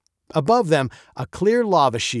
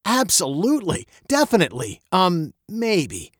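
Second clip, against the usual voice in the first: confidence crumbling into doubt; hesitant stammer; 6 semitones higher than usual. A narrator's reading. Father answers his daughter about the pearls, stammering, unsure. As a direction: start sure, then falter through the filler. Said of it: "he stammered, not sure at all".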